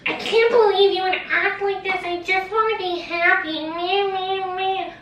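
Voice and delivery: high, loud, whining mockery of the best friend; mocking her friend's tantrum, high and whiny. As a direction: sneer it like a bratty whiner. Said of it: "Her friend was like".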